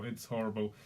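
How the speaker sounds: ordinary talk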